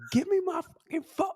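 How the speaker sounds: high-pitched imitation of a furious demand; hammed-up outburst without real volume; mock angry demand. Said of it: "when you told him".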